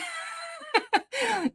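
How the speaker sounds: real laugh, very high then falling; high burst of laughter. Real, not acted. Laughs at her own joke.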